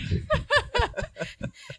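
a real giggling fit; laughing at slipping the name. Real, not acted. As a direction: burst of high laughter. Laughs at almost naming ex.

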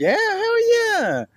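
high, slow, stretched-out exclamation; drawn-out hell yeah. Real, not acted. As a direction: stretch it out with glee. Proudly admits being scared.

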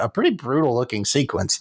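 ordinary talk, nothing marked

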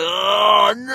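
loud, high cry of disgust; horrified shout. Retold or imitated. Dad reliving finding poo on his shirt, horrified yell. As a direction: yell it like you just found poo on yourself. Discovers skid mark mid-lunch.

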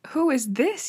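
a startled man stammering at the body; stammered. Acted. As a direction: trip over the words in shock. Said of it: "he stammered".

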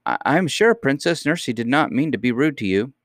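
high-pitched, hesitant peacemaker; smooth things over in a high voice; timid housekeeper soothing a quarrel; placating. Acted. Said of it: "sought to patch matters up".

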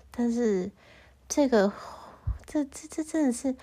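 usual speaking voice

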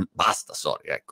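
ordinary talk, nothing marked

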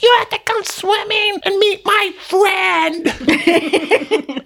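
very high, slow squealing voice; loud friend Beth. Retold or imitated. Husband imitating Beth urging him to swim. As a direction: stretch it out high like the excited friend. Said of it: "And she's like".

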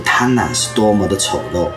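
ordinary delivery

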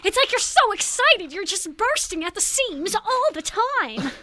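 high, loud, bursting transatlantic excitement; gushing old-Hollywood transatlantic voice; transatlantic accent. Shown as a demonstration. Demonstrating old film style to co-hosts, excited transatlantic accent. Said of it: "Like that".

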